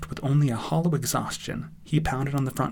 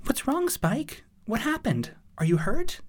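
high, anxious pony guardian; worried, much higher female character voice; worried Twilight. Acted. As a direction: ask with high-pitched concern.

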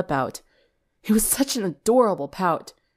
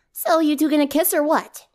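teasing tomboy; 9 semitones higher than usual. Acted. Rainbow teasing the pair.

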